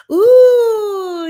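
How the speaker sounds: high, loud delighted ooh; real excited high squeal. Real, not acted. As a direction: squeal with joy at arriving. Co-host greeted joining live.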